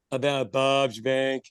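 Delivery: deadpan. Retold or imitated. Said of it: "a deadpan bit".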